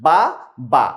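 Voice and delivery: বা, বা as two clear beats with a pause; syllables split. Shown as a demonstration. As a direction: tap out each বা separately. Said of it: "বাবা শব্দে কয়টি অক্ষর". (প্রতিটি অক্ষর আলাদা করে বলা)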